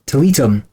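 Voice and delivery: Tilitum said as tuh-LEE-tum; shifted emphasis. Shown as a demonstration. Host playing with the name's emphasis, tuh-LEE-tum. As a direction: stress the middle syllable of Tilitum. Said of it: "all depends where you put the emphasis".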